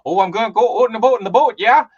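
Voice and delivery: hammed-up cheery Minnesota accent; thick Minnesota accent. Imitating the professor, thick Minnesota accent. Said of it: "drop into these thick Minnesota accents".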